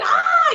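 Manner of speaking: high squeal of a starstruck fan; retold fan scream. Fan shrieking on seeing Oprah, high squeal. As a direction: shriek like meeting your idol. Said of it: "I'm screaming".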